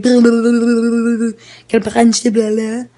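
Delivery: loud mock-French gibberish; garbled stream of French-sounding syllables; incomprehensible French. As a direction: imitate a babbling French speaker with rolled nonsense. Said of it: "we had no idea what he said".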